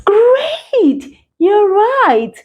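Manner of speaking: high, thrilled "Great!"; proud teacher cheering; delighted praise. Real, not acted. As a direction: cheer the learner on. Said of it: "praising a correct answer".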